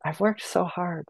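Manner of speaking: slow, wounded inner voice; replaying a hurt, pleading thought; inner protest. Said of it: "And I'm like".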